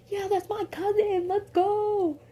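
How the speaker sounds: high, excited cousin cheer; hype cheer. Retold or imitated. Vance hyping up his cousin in the fight, high and excited. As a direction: cheer proudly for family.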